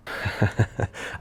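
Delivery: a soft, breathy giggle to yourself; a giggle you can't hold back at something silly; an unvoiced little laugh; real laugh. Real, not acted. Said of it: "I don't know why I'm laughing".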